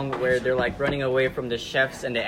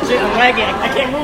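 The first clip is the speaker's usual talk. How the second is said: hyped gamer bro; 6 semitones higher, 8 dB louder than usual. Real, not acted. Game lagging, panicking.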